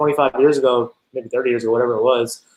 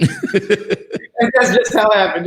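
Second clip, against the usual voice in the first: slow, higher, through laughter; laughing; 9 semitones higher, 3 dB louder, much slower than usual. Real, not acted. Telling the story of the family's move, laughing. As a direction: laugh the words out, amused. Laughs after the mock quote.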